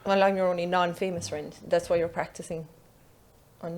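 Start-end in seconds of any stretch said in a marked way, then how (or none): none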